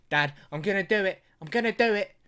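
South London accent, hammed-up threat; rough South London accent. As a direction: put on a shaky attempt at South London. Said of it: "I don't know what that accent is".

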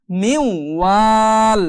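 long nasal glide from "মিম" into "ওয়াল"; merged ghunna. Shown as a demonstration. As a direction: draw out "ওয়াল" after a held nasal w. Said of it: "ইদগামের হরফে মিলিয়ে পড়তে হয়".

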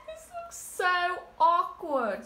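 put-on joking British accent, drawn out; hammed-up British accent. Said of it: "Sorry for the British accent".

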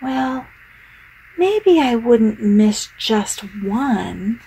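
slow, wavering decision; hesitant. Acted. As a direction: give in slowly, unsure. Rainbow Fish hesitates.

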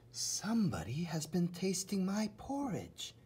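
whispered, mother bear role; hushed whisper of the middle-sized bear. A narrator's reading. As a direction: breathe it out softly as the middle bear. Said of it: "in her middle-sized voice".

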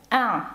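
"un" as a French nasal vowel with smile-spread lips; nasal, smiling. Shown as a demonstration. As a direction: say "un" through the nose as if smiling, no sounded N. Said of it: "ви ніби в посмішці вимовляєте".

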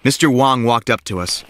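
plain reading voice

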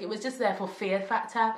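normal talking voice

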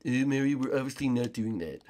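a lightly put-on snotty kid denying it; the little prince insisting they aren't cheating; Bowser Junior voice. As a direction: brush off the accusation in character. Voices the accused team.

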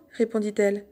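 ordinary delivery, nothing marked

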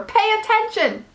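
high, urgent yell; screaming. A narrator's reading. Wilbur orders the groggy rat to listen, screaming high. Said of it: "screamed Wilbur".

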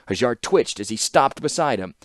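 plain reading voice

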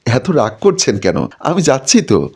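plain reading voice

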